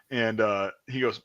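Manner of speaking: usual speaking voice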